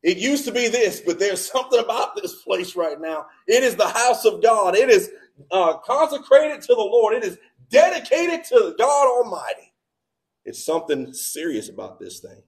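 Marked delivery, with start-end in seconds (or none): 3.5-9.7 loud, high preaching build. Acted. Pastor preaching to the congregation, loud and raised. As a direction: proclaim it to the whole church. Preacher rising to full force.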